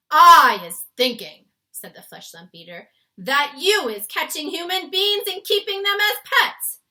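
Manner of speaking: loud, high booming giant voice; accusing giant. A narrator's reading. Flesh lump eater accusing.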